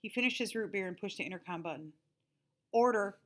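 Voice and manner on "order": higher, louder tinny speaker voice. A narrator's reading. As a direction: squawk it through a cheap intercom. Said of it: "said the tinny voice".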